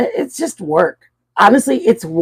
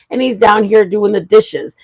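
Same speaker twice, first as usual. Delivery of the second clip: usual speaking voice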